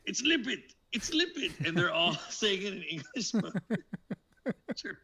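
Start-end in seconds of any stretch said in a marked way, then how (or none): 0.1-1.5 high-pitched imitated cry of alarm; acted movie call-out. Imitating German sailors spotting Limpet, high-pitched call. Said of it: "He's like".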